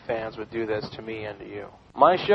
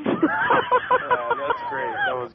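laughing; much slower than usual. Real, not acted. Host laughing at the call.

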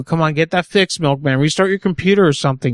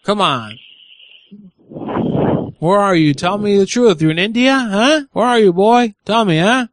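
badgering; 6 semitones higher than usual. Real, not acted. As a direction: hammer him with questions, getting heated. Badgering the call center agent.

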